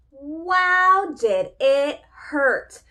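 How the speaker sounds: hammed-up storybook drama; dramatic emphasis. A narrator's reading. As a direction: make the hurt big and slow.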